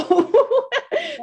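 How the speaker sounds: high, loud burst of laughter; a sudden real laugh. Real, not acted. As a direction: laugh out loud at your own joke. Laughing at her own joke.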